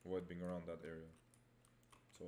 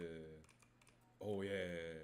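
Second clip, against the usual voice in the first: ordinary talk, nothing marked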